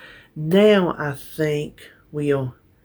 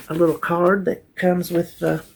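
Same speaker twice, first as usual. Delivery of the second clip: usual speaking voice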